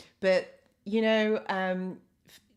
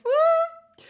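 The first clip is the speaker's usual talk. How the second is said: very high, loud "woo"; excited whoop; 10 dB louder than usual. Real, not acted. Host cheers on West London viewers, excited whoop.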